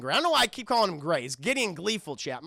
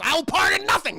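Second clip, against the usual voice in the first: loud, high hammed-up outburst; defiant mock shout; 10 semitones higher, 8 dB louder than usual. Stan defying a sign at the door, loud mock shout. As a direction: throw it out as an acted roar.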